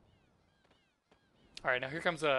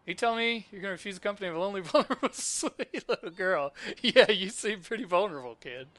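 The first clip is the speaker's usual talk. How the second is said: hammed-up helpless-little-girl plea, higher; mock pleading; 6 semitones higher, 7 dB louder than usual. Kid begging Serge for company, mock pitiful then teasing. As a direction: lay on the fake vulnerability, stretching it out.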